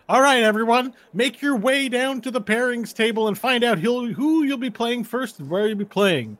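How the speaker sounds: loud announcer voice stumbling over the event name; intercom announcer. Acted. GM voicing the announcer to the players, hammed-up tournament host. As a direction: boom it out like a tournament host on the speaker.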